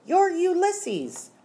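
high, slow rallying cry; emphatic call. A narrator's reading. Flora answers Ulysses's unspoken question, high drawn-out call. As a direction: stretch it out high to rouse the listener. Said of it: "she answered him".

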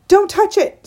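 high, panicky shout; screamed warning. A narrator's reading. Mother as daughter reaches for the squirrel, screaming a warning. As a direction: scream it as an urgent order. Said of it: "screamed her mother".

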